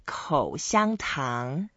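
口香糖 drawn out syllable by syllable; slow pronunciation demo. Shown as a demonstration. Teacher having guest repeat chewing gum, slow pronunciation demo. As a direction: model 口香糖 very slowly, each tone distinct. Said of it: "let's repeat one more time".

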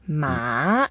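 MA gliding upward; second tone. Shown as a demonstration. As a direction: start "Ma" low and let it climb. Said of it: "Ma is the second tone".

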